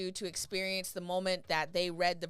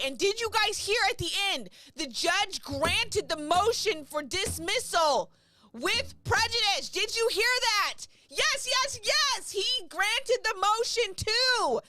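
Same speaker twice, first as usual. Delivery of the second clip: much higher, loud elated exclamation; 11 semitones higher, 5 dB louder than usual. Real, not acted. Streamer telling viewers about the dismissal, elated. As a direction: burst with delight.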